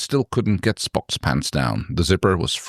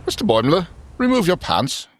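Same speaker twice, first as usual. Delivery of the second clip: ordering; 10 semitones higher than usual. Acted. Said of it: "Commander Spock ordered".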